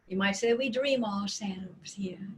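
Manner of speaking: soft unvoiced whisper. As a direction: breathe the words out in a whisper. Whispered aside while channeling.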